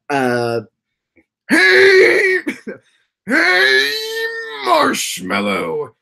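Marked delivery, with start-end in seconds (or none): 1.5-5.9 high, much louder, strained cartoon host greeting; strained character voice. Host character greeting Marshmallow, high strained shout. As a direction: belt the welcome till the voice cracks. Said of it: "damaging your voice is not okay".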